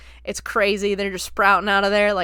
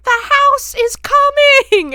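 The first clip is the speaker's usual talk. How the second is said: slow, stretched, very high scream; terrified warning yell; 11 dB louder, much slower than usual. Retold or imitated. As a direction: imitate a panicked kid shouting a warning. Voicing Robbie's terrified warning.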